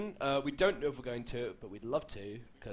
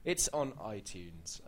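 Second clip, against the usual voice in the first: quiet; 8 semitones lower, 8 dB quieter, much slower than usual. Shown as a demonstration. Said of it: "we always say it".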